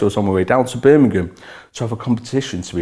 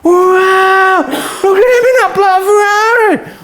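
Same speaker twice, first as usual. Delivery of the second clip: shouting, much higher and louder; amazed onlookers; 7 dB louder, slower than usual. Retold or imitated. People in town shouting in amazement at the Ferrari. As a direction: cry out in awe. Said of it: "They were like".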